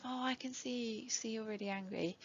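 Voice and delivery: hushed, soothing, a little too gentle; the over-soft tone to avoid; soft calm voice. Shown as a demonstration. Parent calming an angry child with a hushed soothing voice. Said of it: "instead of".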